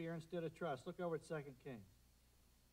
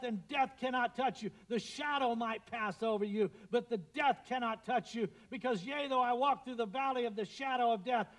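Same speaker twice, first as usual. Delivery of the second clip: booming, much louder declaration of God; God's voice preached; 5 semitones higher, 7 dB louder than usual. Acted. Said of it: "And he said".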